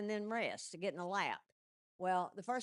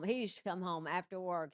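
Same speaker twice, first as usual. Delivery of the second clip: ordinary talk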